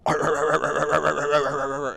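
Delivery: loud, high, rapid-fire mock barking; imitated dog barking. Player mimicking the saved dog's annoying noise, mock barking. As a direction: yap "arr" over and over, loudly. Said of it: "that sound was annoying though".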